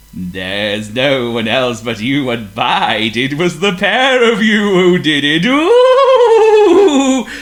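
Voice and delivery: slow, loud, booming ghostly voice; eerie ghost voice. A narrator's reading. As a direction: boom like a spooky conscience. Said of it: "came the eerie voice again".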